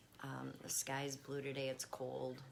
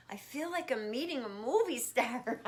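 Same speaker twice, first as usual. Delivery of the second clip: loud high gushing; retold excited quote; 10 semitones higher, 10 dB louder than usual. Retelling a fan meeting her cat, loud excited gushing. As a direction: gush like meeting a celebrity. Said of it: "they said".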